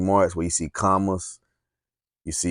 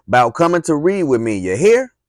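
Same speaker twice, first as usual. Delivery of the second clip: high, loud holler ending in a rising you hear; 8 semitones higher, 7 dB louder than usual. Acted. Teacher hollers after the girl running off, loud and high. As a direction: call it out across the yard. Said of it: "She hollered at me".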